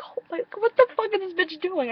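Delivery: shocked fangirl. Retold or imitated. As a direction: gasp it out in delighted shock. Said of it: "but I was like".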